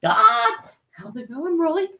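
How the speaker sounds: mock Whis voice. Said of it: "And Whis is just like".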